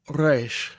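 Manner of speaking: gargle the R in resh; gargled resh. Shown as a demonstration. Teacher demonstrating an emphatic gargled resh. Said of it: "resh is spoken at the back of your throat".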